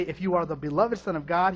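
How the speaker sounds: ordinary talk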